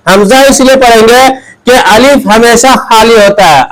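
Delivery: usual speaking voice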